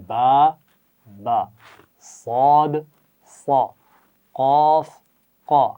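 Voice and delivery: "با" and "قا" said as short clear letter sounds. Shown as a demonstration. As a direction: sound out "صاد" then "صا" crisply. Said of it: "جیسے میم، ما".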